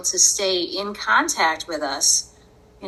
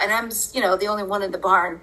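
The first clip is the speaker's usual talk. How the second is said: ordinary talk, nothing marked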